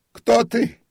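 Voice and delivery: loud, very high terrified cry; sharp unnatural shout. A narrator's reading. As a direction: shriek it in a voice not your own. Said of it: "крикнул он резким, не своим голосом".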